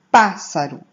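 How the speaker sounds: Pássaro with a loud, strong first syllable PÁ; stress on PÁ. Shown as a demonstration. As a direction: hit PÁ in Pássaro, let saro fall away. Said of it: "Pá é a sílaba tônica". (Pássaro com o PÁ bem forte)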